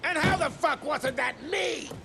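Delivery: indignant outburst. Acted. Secretary outraged at being unprotected.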